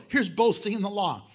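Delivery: normal talking voice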